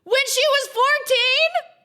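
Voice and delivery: very high, loud, drawn-out shriek; appalled shout. Real, not acted. Player appalled that she was fourteen, shrieking. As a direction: shriek it in horrified disbelief. Shocked reaction to the age.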